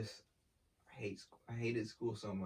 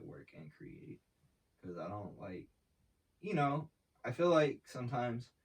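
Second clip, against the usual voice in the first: ordinary delivery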